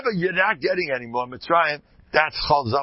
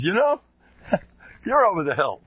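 pitched higher, cheeky quip; younger colleague's jab; 5 semitones higher than usual. Retold or imitated. Younger rabbi teases the old teacher, higher, cheeky. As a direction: toss it off like a teasing younger man. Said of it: "He says".

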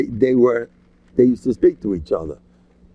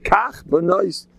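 quoting father-in-law; 7 semitones higher, much slower than usual. Retold or imitated. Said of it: "My Shver used to say".

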